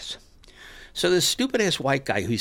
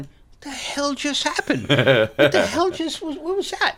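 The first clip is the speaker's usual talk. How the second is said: slow, higher baffled questioning; bewildered; 12 semitones higher, slower than usual. Retold or imitated. Stunned audience wondering what happened, slow baffled questions. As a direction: puzzle aloud in disbelief. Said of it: "we're going".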